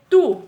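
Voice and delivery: "Tu" hit hard and loud; strong TU. Shown as a demonstration. Teacher showing pupils a strong monosyllable, stressed "Tu". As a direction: punch the single word "Tu". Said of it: "ela é forte também".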